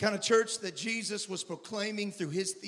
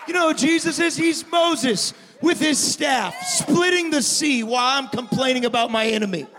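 preaching at full force; 8 semitones higher, 10 dB louder than usual. Acted.

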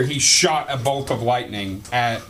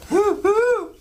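excited cheer. Real, not acted. Cheering a failed hit.

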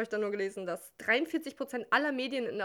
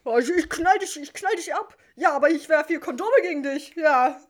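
mock battle cries; 6 semitones higher, 9 dB louder than usual.

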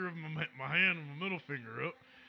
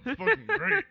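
much higher, louder squeal; shrieking future self; 9 dB louder than usual. Acted. Future Victor bragging to Andy in a squeal. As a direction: squeal it with delight.

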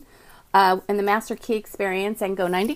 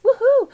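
high, loud cheer; quick happy whoop; short excited cheer; little woohoo; 7 dB louder than usual. Real, not acted. Celebrating the show start.

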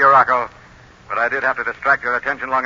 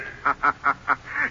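mocking laugh; 4 dB quieter than usual. The Shadow mocks his foe.

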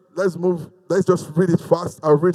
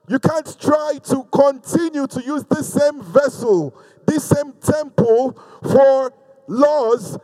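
raised, insistent sermon voice; emphatic preaching; 6 semitones higher than usual. Real, not acted. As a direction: preach it higher, leaning on key words. Preaches consecration to congregation.

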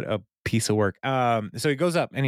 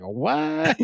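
high, baffled single word; baffled what. Retold or imitated. Donna caught off guard, a high baffled what. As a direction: react like the remark made no sense.

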